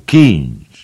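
a loud call across the room. A narrator's reading. Mulligan calls Stephen from the stove, loud call. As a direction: shout the name from over the stove. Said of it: "zawołał".

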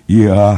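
a loud, high yawning "Ja!"; yawned yes. Acted. Byrne nods mid-yawn, loud high yawned yes. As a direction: let the yes stretch out of a yawn. Byrne yawns while nodding.

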